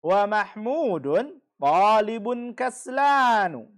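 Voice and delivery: slow, loud and deliberate; model sentence. Shown as a demonstration. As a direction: spell it out slowly for the class to repeat. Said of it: "teacher reads example sentence aloud". (pelan, keras, jelas seperti guru)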